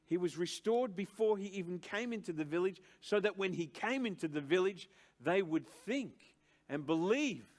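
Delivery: preaching at full force. Acted. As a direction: drive the definition home from the pulpit. Preacher building on restoration.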